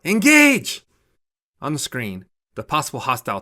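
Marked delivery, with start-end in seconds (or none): 0.0-0.8 piercing high shouted order; yell. A narrator's reading. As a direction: scream the command at full force. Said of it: "She yells out".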